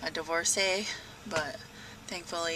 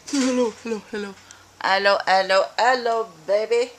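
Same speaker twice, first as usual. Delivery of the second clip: cat talk; 9 dB louder than usual. Real, not acted. Greeting the cat.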